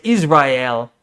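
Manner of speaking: Israel said as "iz-rye-EL"; imitated pronunciation. Streamer doubting any Bostonian says Israel so, imitated pronunciation. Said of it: "I've never heard of a Bostonian saying that".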